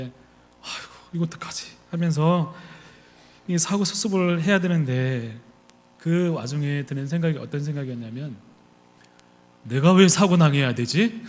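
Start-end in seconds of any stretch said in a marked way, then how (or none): 0.0-1.8 breathy, near-whispered fret, softer; worried whisper. Retold or imitated. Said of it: "이렇게 심각하게 하면 내리시는데". 9.6-11.2 louder, slow, resentful question; indignant outburst. Retold or imitated. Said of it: "하는 생각이었습니다".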